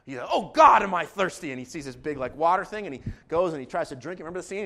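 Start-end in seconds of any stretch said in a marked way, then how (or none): 0.3-1.5 loud, high comic outburst; exclaimed thirst. Retold or imitated. Pastor acting out Chunk seeing water, exclaimed thirst. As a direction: cry it out as the thirsty kid. Voicing parched Chunk in retold film scene.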